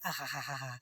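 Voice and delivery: a soft, crooned ahahaha; crooning aha. Pretend. Vlogger crooning between song lines, soft sung vocalise.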